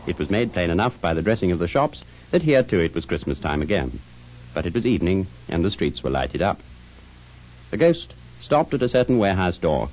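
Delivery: ordinary talk, nothing marked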